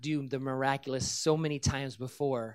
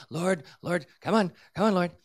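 urgent plea; 3 semitones higher, 6 dB louder than usual. Retold or imitated. Retold urgent prayer over fallen woman.

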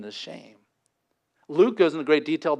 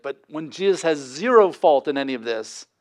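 ordinary talk, nothing marked

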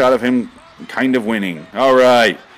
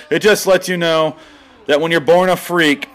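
ordinary delivery

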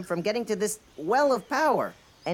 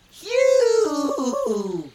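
sputtering phew; 12 semitones higher, 7 dB louder than usual. Acted. Hit by water basin.

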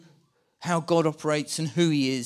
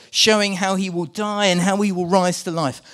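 usual speaking voice

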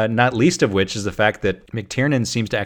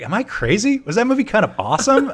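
incredulous self-doubt; 11 semitones higher than usual. Retold or imitated. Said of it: "I've been like".

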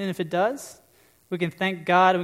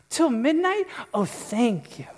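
much higher, gushing princess voice; delighted Cinderella; 6 semitones higher, slower than usual. Retold or imitated. Cinderella thanking her fairy godmother, high delighted voice. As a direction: Cinderella thrilled with her gift. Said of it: "And she goes".

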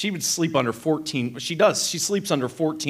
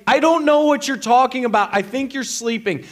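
higher, loud mock-quarrel retort; exasperated wife; 8 semitones higher, 4 dB louder than usual. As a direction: imitate a fed-up wife arguing back. Acted quarrel, wife's side.

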